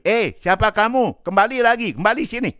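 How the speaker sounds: high, loud call to a man walking away. Acted. Umar calling the departing man back, loud high call. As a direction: shout him back from the doorway.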